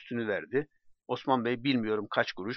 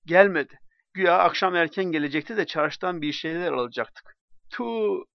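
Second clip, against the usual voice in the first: higher and louder angry outburst; furious near tears; 6 semitones higher, 6 dB louder than usual. Acted. Wife waiting at the door for her drunk husband, furious. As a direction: spit out the anger of a wife waiting. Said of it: "Kadın ağlayacak kadar hırslıydı".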